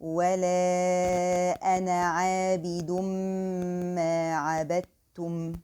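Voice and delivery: Quran recitation. Shown as a demonstration. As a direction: chant the verse evenly. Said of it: "The next verse".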